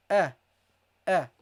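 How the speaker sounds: A said alone as a short open vowel; short open A sound. Shown as a demonstration. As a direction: say A as a clean, separate sound. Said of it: "you read this as A".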